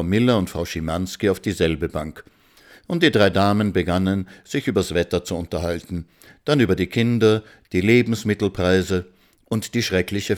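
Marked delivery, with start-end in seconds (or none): none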